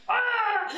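sudden high cry of dismay; short high shriek; panicked high yelp. Retold or imitated. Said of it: "I'm like".

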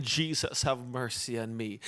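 soft, low, pleading cry for mercy; hushed begging; quiet plea. Acted. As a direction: murmur it like a beggar who barely dares to ask. Blind beggar crying to Jesus.

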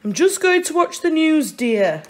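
breezy dad heading for the telly; Mr Large voice. Acted. Mr Large tells his wife he'll watch the news, breezy. Father comes home from work.

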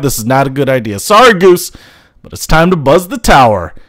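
ordinary delivery

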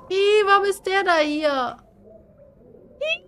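normal talking voice

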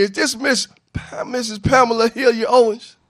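normal talking voice

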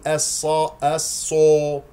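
"الصا" rounded toward "الصو"; mistaken rounded lips. Shown as a demonstration. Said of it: "لا تكور الشفتين". (نطق "الصا" خطأً بتكوير الشفتين)

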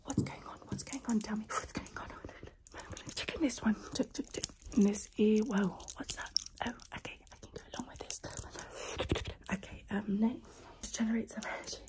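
close-mic whisper, guiding the listener; ASMR whisper. Acted. Whispering to the viewer during an ASMR roleplay.

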